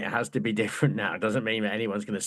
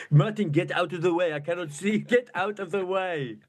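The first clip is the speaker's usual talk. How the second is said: exasperated Wenger; 6 semitones higher than usual. Acted. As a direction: act out a manager fed up with his player. Acted exasperated Wenger scolding Keown.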